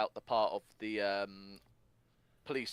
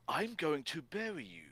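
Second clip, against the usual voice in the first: Sonic/character threat; 6 semitones higher, slower than usual. Acted. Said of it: "And then Sonic's like".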